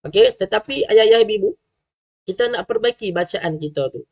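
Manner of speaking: ordinary talk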